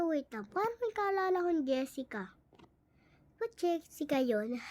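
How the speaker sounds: normal talking voice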